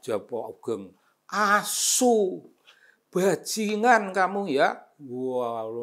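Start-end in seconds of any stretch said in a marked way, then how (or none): 1.3-4.8 high, loud cursing; furious insult down the phone line; mock angry cursing. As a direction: swear at the caller in a rage.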